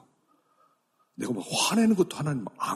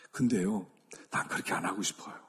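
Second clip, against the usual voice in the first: ordinary talk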